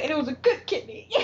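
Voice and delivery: high-pitched quote through laughter; laughing retold line. Host retells mother's joke about the rejected kidney, laughing. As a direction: imitate the character while cracking up.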